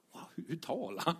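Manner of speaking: breathy hushed whisper of wonder; awed whisper, remembering the moment; amazed whisper. Retold or imitated. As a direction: whisper it like you can't believe it.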